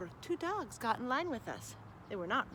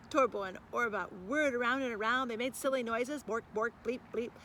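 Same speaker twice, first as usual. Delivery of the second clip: ordinary delivery, nothing marked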